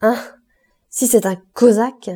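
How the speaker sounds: exclamation plus forte, portée par l'élan; élan patriotique crié. Acted. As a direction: crie ton patriotisme à la table. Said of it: "Il cria". (cri patriotique, plus fort)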